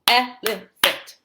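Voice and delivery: "elephant" slowly as el-e-phant, first beat strongest; syllable by syllable. Shown as a demonstration. Teacher modelling a new word, "elephant" slowly in syllables. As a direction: stretch "elephant" into three clear beats. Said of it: "break it down by syllable".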